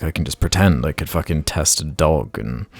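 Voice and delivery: ordinary delivery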